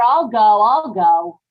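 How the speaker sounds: loud, commanding repeat; firm loud insistence. Acted. Wife stopping her husband from going to the door, loud and firm. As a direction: stop him cold, loudly.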